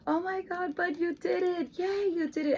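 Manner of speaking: high, gushing praise for her son; exaggerated praise. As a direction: cheer him on way up high, over the top. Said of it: "And I exaggerate a lot".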